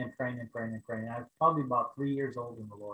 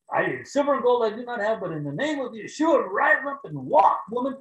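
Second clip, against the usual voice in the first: bold apostle command; 9 semitones higher, 6 dB louder than usual. Pretend. Imagined apostle commanding the woman to walk, booming. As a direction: command her like Peter and John. What he expected to say like Peter.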